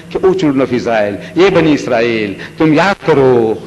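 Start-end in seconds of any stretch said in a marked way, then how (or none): none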